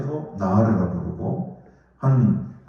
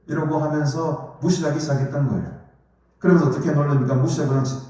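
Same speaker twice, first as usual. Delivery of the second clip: usual speaking voice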